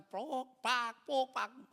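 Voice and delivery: quick mouthed smacking noises; imitated slaps. Preacher recalls being hit, imitated slapping sounds. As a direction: mimic a string of slaps.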